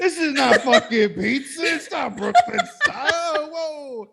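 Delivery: high, laughing mock complaint; whiny Italian-American tourist. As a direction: squeal it out like a spoiled tourist, laughing.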